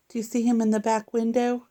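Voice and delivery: ordinary delivery, nothing marked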